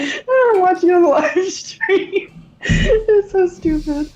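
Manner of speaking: after laughing hard. Real, not acted.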